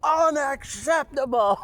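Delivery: slow, high, loud mock yell; imitated yell. Nurse mimics the patient yelling unacceptable, slow and loud. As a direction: draw it out like an outraged patient. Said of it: "She yells".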